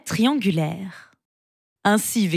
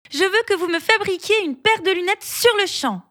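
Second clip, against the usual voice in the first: demanding mole; 11 semitones higher than usual. Acted.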